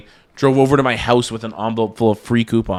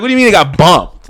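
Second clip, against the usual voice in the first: mock indignation; 6 semitones higher, 7 dB louder than usual. Retold angry complaint to worker.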